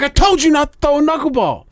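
exasperated scolding. Retold or imitated. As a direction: whine it at the pitcher who ignored you. Retold scolding of teasing pitcher.

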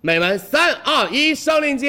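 high, loud countdown hype; hard sell ad. Real, not acted. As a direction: shout the countdown to launch the link. Livestream countdown to product link.

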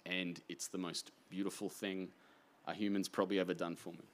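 soft, moved and quiet; a brother moved by a memory, softly emotional; getting emotional. Real, not acted. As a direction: let the feeling catch you as you speak. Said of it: "I genuinely am getting emotional here".

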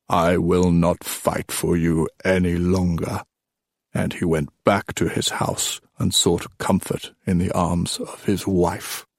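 put-on slow, weighty old storyteller; old king storytelling. Old king telling of Meleager's rage, slow storytelling. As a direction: draw the legend out gravely.

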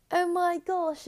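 high-pitched put-on British accent. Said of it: "in a British accent".

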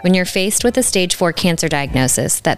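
confident host voiceover; podcast intro read. Acted. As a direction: sell the show like a polished intro. Scripted podcast intro over music.

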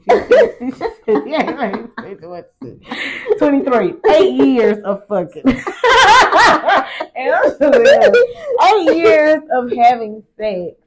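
tipsy and giggling loudly. Real, not acted. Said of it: "I'm so drunk right now".

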